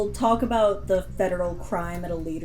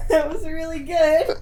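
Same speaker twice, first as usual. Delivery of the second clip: high laughing praise; laughing through the praise; 9 semitones higher than usual. Real, not acted.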